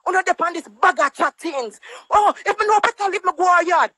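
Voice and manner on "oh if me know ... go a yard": mocking quote. Mocking her bluster again.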